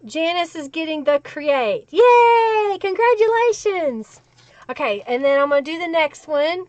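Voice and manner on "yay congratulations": high, loud celebratory yay; delighted congratulations, voice lifted high; excited cheer. Real, not acted. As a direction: cheer for the winner, pitched high. Cheering a giveaway winner.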